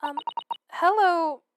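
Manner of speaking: a hint of a shy young girl greeting. Gamer reads Iris greeting Sholmes, lightly timid. Iris voiced in game dialogue.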